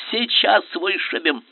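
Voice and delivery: high, slow, forceful push; eager shout. A narrator's reading. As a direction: keep the battle cry going. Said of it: "крикнул Туталмин". (напористый выкрик)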